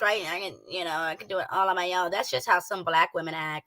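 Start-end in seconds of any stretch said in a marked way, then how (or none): 0.3-2.1 lower, softer mock of the woman; imitating her voice. Streamer imitating how the woman talks, low and soft. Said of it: "this is how she talks".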